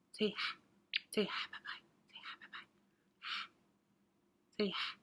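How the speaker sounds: breathy whispered coaxing to the pet. Acted. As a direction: whisper softly so the pet will say bye.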